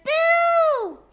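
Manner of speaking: high, loud mock scare; playful loud boo. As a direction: jump out with a boo for the kids. Mouse scares in song.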